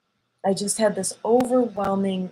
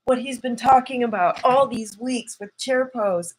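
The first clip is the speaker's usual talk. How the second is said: excited inner voice; 6 semitones higher than usual. Retold or imitated. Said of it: "And I was like".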